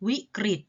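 วิกฤต with ฤ said "ri". Shown as a demonstration. Teacher models for pupils, วิกฤต with a short "ri". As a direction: say วิกฤต as wi-krit. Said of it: "ตัวรึ ออกเสียงริ".

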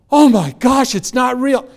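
high, loud burst of mock amazement; amazed realisation. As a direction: exclaim it like a sudden revelation. Girl's sudden realisation, retold.

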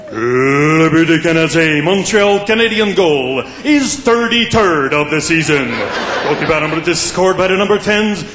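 big bilingual goal announcement; Claude Mouton. Acted. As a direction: stretch out each word over the arena PA. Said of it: "Claude Mouton would let you know".

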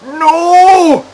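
long, high, loud wail of despair; anguished cry. Real, not acted. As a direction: cry out No! as if the world is ending. Despair at the rain starting.

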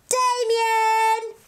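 a bright welcoming call. Acted. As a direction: call him out to join. Counting up, calling the friend.

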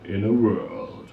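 slow, low trailer announcer; movie trailer voice. Shown as a demonstration. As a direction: intone it like a film trailer. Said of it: "als Kinotrailersprecher".

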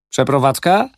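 Przeprowadzka? asked high and loud, rising; casual question. A narrator's reading. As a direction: toss off Przeprowadzka? in passing.

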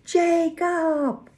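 loud, very high drawn-out call; unrestrained high-pitched shout; desperate yell. A narrator's reading. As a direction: yell the name across the water. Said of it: "I yelled".

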